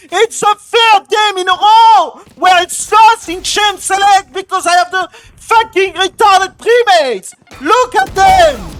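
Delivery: high, loud, enraged yelling; furious shouting. Real, not acted. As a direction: scream that the game is already lost. Raging, game lost in champ select.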